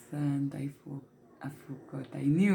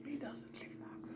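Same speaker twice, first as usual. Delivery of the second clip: a much softer breathy whisper; whispered aside; 9 semitones higher, 19 dB quieter, faster than usual. Real, not acted. Joking aside to the camera, whispered much softer. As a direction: whisper it like a secret joke.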